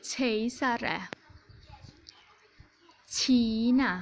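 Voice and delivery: each word from เชยซาแระ to แชป begun with soft hushing ch; soft ch sound. Shown as a demonstration. Teacher reading the right-hand Swedish list, เชยซาแระ to แชป with soft ch. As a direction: model ชีนะ, ชิกลิง, แชป one by one with long pauses, soft ch. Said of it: "ตัวพวกนี้ก็จะออกเสียงเป็นชอช้าง".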